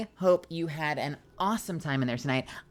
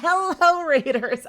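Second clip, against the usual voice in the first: much higher and louder hello; excited hello; 12 semitones higher, 11 dB louder, slower than usual. Real, not acted. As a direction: welcome the raiders with delight. Greeting raiders again.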